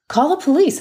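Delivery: high, loud barked command; shouted order. Acted. Panicked banker orders the nurse to call police, loud shout. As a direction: yell the order at her in panic. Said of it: "Almasi shouted".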